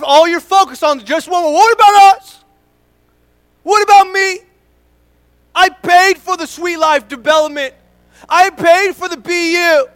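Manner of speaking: indignant, whiny and loud; griping churchgoer. Pretend. Pastor voicing a member who paid and served, complaining loudly. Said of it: "It's the other services".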